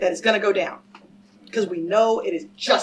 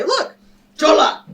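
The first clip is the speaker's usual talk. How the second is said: mocking catcall; 5 semitones higher, 7 dB louder than usual. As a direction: sneer it like a stranger calling out. Mocking what strangers would say.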